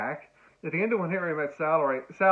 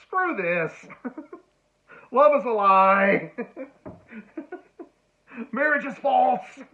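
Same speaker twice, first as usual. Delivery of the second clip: mock bitter outburst, higher and louder with laughs between; cynical; 8 semitones higher, 7 dB louder, slower than usual. Unmoved viewer scoffing at the romance, hammed-up bitterness. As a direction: spit it out like a jaded cynic. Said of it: "you think".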